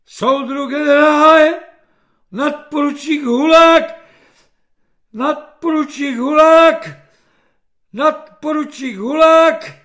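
high, loud, panicked military reporting; stuck gramophone. A narrator's reading. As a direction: repeat it identically like a stuck record, terrified. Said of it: "opakoval tato dvě slova jako gramofon". (vyděšené hlášení jako zaseknutý gramofon)

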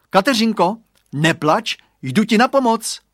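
put-on boy shouts high and loud into the fog; determined boy's cry; shouting. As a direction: call out bravely to the rescue. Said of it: "vykřikl".

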